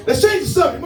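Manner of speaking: normal talking voice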